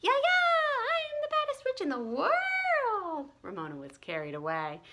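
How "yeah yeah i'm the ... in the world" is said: high, loud, slow gleeful shout; high shrieking witch boast; witch taunt. Acted. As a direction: yell it like a kid playing the baddest witch. Ramona carried away shouting.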